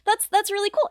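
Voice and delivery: overeager excitement. Retold or imitated. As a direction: gush like you just found a kindred spirit. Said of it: "And I was like".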